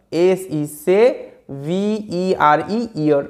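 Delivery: letter by letter, slow; slow spelling out. Shown as a demonstration. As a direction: spell out each letter slowly. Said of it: "একটা বিষয় মাথায় রাখবে". (ধীরে ধীরে বানান করে বলা)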